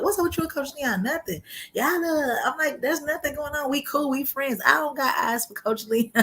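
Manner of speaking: teasing friends. Retold or imitated. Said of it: "always like".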